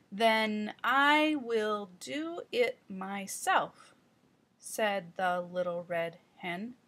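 slow, careful early-reader pace with restarts; child reading aloud with repetitions; slow halting read-aloud. Shown as a demonstration. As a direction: read like a young child sounding out a story, repeating words. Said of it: "Let's practice on sections eight".

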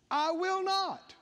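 climbing preacher's emphasis on "I will not". Shown as a demonstration. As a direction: lift it up and lean on it like a promise. Preacher repeats promise, building.

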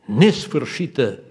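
loud, slow preaching. Acted. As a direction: hold every word out to the church. Sermon climax.

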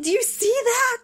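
very high, thrilled exclamation; giddy, high-pitched disbelief; really excited. Retold or imitated. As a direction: burst out like an excited child. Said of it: "I get really excited".

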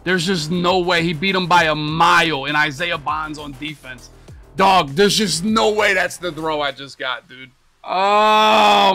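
outraged yell. Real, not acted. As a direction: shout at the screen in outrage. Outraged at a bad throw.

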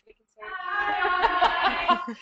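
high, slow, laughing greeting; drawn-out giggly hello. Real, not acted. Room greets the webinar.

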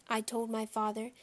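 usual speaking voice

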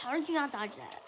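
ordinary delivery

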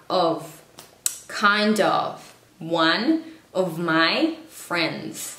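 "of" ending in V, not F; slow careful examples, "of" with V sound. Shown as a demonstration. Teacher giving examples, "of" pronounced with a V. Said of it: "se pronuncia como una V".

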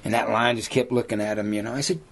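ordinary delivery

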